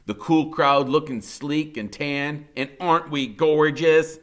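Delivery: usual speaking voice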